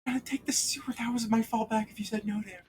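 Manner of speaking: high, soft sneaky voice; sly Pat aside. Acted. GM voicing Pat admitting the sewer was his fallback, high and hushed. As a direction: slip it out high and hushed, like a confession.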